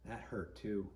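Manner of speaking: ordinary delivery, nothing marked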